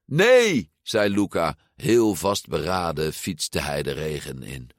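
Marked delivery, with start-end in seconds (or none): 0.1-0.7 high, loud refusal; defiant cry. A narrator's reading. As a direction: cry out in protest. Friend defiantly refuses to give up. (luide weigering)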